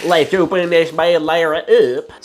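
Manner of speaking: hammed-up country tow-truck voice; Tow Mater. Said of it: "Let me say it like Tow Mater".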